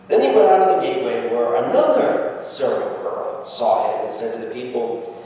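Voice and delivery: ordinary talk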